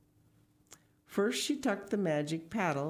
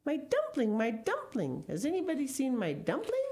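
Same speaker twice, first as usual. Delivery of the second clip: high-pitched storybook calling out; mild shout, high and singsong; 10 semitones higher than usual. A narrator's reading. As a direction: call for the runaway dumpling, pitched way up.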